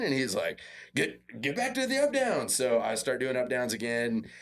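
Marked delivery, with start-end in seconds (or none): 1.0-2.5 high-pitched coach's command; coach barking orders. Retold or imitated. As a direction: order a player back to work, pitched high. Coach ordering more drills, retold.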